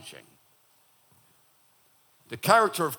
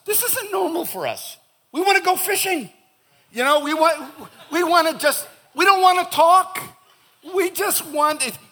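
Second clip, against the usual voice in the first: very high-pitched comic protest; mock exasperated protest; 12 semitones higher, 5 dB louder than usual. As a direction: plead the men's case, voice shooting way up. Mock protest on behalf of husbands.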